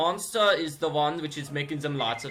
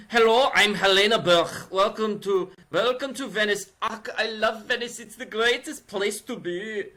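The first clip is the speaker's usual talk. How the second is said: put-on higher, overdone German accent; heavy German accent; 6 semitones higher than usual. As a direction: ham up a thick German accent. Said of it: "she had a heavy accent".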